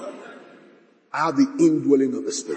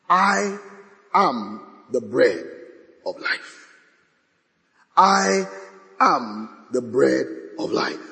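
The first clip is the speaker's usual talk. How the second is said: solemn proclamation; 3 semitones lower, slower than usual. Acted. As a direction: declare it slowly and majestically, with weighty pauses.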